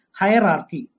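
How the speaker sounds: normal talking voice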